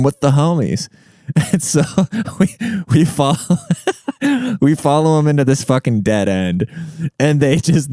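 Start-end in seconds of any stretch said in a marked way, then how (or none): 3.4-4.6 high giggle cutting off the sentence; real high-pitched chuckling burst; cracking up. Real, not acted. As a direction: lose it laughing mid-story. Cracks up at own story.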